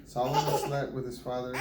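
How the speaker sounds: normal talking voice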